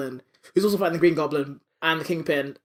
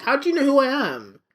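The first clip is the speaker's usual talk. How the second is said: high-pitched, baffled villain; hammed-up disbelief; mock astonishment; 9 semitones higher than usual. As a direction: blurt it out in pitched-up mock shock. Voicing unmasked Hobgoblin, mock shock.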